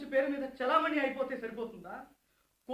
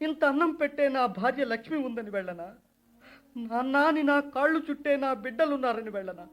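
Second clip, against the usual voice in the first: anguished weeping plea. Acted. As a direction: cry out to father that nobody is left. Son grieves, refusing to go home.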